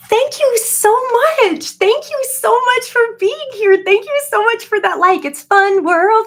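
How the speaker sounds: high, loud gushing hello; gushing greeting. Acted. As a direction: squeal like an overjoyed hostess.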